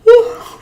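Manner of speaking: loud, high exhausted sigh; heavy weary sigh. Real, not acted. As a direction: let out the tiredness in one breath. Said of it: "wake up".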